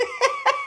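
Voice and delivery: very high squealing laugh; high squeak of laughter. Real, not acted. Host squeals after nearly swearing at the Oreos.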